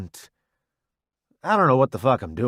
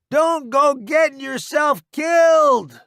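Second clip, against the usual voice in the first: high slow loud call; calling after; 13 semitones higher, 6 dB louder, slower than usual. Acted. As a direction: shout a warning after him.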